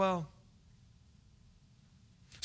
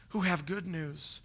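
ordinary delivery

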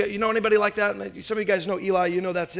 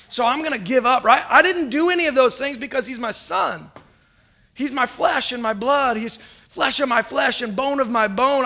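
preaching at full force; 4 semitones higher than usual. Acted. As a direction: build the fire of resolve.

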